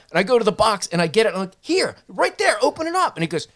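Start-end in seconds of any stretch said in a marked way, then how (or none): 1.6-3.2 high-pitched pushy retort; exasperated insistence. Retold or imitated. Friend shows Deco his hoagie in the box, exasperated. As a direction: prove it to him, voice pitched up.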